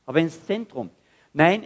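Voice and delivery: normal talking voice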